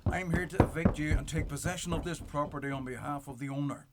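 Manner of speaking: ordinary talk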